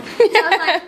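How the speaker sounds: ordinary delivery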